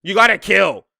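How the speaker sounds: ordinary talk, nothing marked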